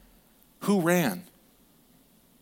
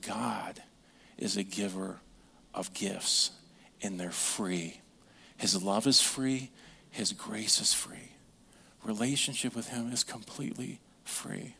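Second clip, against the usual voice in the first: soft tender father; 5 semitones lower, 8 dB quieter than usual. Retold or imitated. Father gently teaching son about grace.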